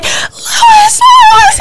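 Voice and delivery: high, shouted, tearful cries of his name; crying out in panic. Retold or imitated. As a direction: sob his name in terror. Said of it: "And I'm crying".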